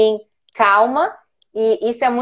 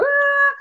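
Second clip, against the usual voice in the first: high-pitched mock crying; thin, high mock wail; imitated child's wail. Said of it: "E ele chorando".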